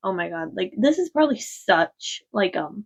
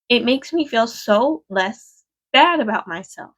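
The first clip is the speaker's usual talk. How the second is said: usual speaking voice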